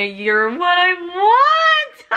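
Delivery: high, drawn-out squeal into laughter; swooning laugh-cry squeal; overcome squeal. Real, not acted. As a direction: gush it slowly, nearly crying with laughter. Overcome reading a romantic line.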